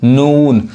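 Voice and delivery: नून with a slow, held "oo"; long sound. Shown as a demonstration. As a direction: stretch the vowel in नून. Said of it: "थोड़ा slow पढ़ाई होता है".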